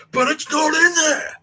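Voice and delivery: slow, high, loud shout; angry yelling. Retold or imitated. Housemaster denying the name is there, angry yelling. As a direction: bellow it back, stretching the words.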